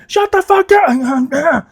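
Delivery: high, drawn-out hammed-up yell; exasperated outburst. Snapping at friends who flood his messages, mock-furious high yell.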